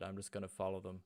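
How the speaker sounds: ordinary talk, nothing marked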